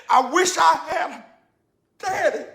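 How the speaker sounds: plaintive cry, pausing before "daddy"; overcome with emotion. Retold or imitated. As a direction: let the longing swell and catch on "daddy". Retelling boy's words that broke him.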